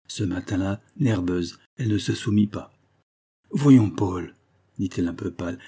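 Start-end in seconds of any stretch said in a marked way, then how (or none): 3.5-4.3 loud, impatient appeal; exasperated protest. Acted. Nervous wife resisting her jealous husband, exasperated. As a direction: cut him short with exasperation.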